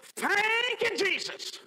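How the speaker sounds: high ringing thank you; mama's praise cry. Retold or imitated. Mama calling out in her sermon, high. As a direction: call it out high. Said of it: "Mama would start and go".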